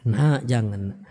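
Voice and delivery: put-on a parent calling a child softly even when angry; tender call to a child. Shown as a demonstration. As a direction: gently coax a child, never scold. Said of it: "panggil nak".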